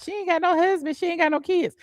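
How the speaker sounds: high, sing-song mimic of gossiping commenters; mocking the trolls. Mimicking the trolls' gossip about her, high and mocking. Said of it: "y'all was talking about".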